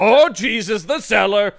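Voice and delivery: loud, high burst of alarm; alarmed exclamation. Retold or imitated. Van Helsing realizing danger, loud mock alarm. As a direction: blurt it out in sudden panic. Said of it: "Van Helsing instantly is like".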